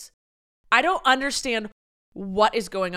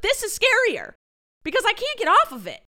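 much higher, louder, exasperated; shrill exasperated rant; 11 semitones higher, 6 dB louder than usual. Real, not acted. Complaining about annoying TikTok sounds, frantic.

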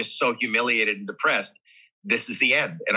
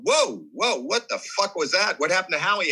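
shocked crew; 7 semitones higher than usual. Retold or imitated. Studio crew reacting in shock.

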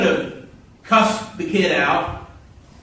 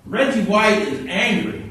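normal talking voice

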